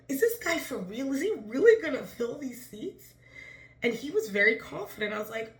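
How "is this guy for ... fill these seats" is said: higher and louder, incredulous; excited disbelief. Retold or imitated. Wondering if the organizer is for real, excited disbelief. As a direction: ask it wide-eyed and keyed up. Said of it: "And I'm like".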